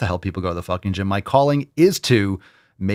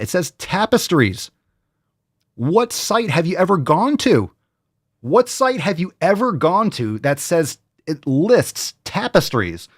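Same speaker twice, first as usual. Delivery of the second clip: hyped pitch; 7 semitones higher than usual. Acted.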